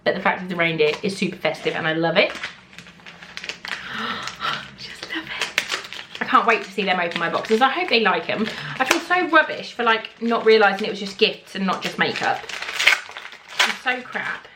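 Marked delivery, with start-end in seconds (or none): 2.3-6.2 breathless whispered gasp; awed whisper. Real, not acted. Whispered reaction to gift. 12.4-14.5 slow, whispered self-reproach; whispered groan. Real, not acted.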